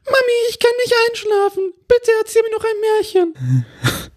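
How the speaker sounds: put-on very high little girl voice; squeaky sleepy child begging; disguised child voice. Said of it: "mit verstellter Stimme einsprechen".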